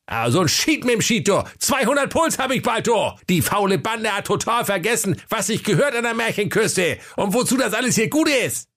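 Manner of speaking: very high, strained outburst; a pompous brother losing his temper; Wilhelm blowing up. Acted. Wilhelm bursts out at the lazy fairy-tale staff, very high. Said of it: "platzte dem Wilhelm Grimm der Stehkragen".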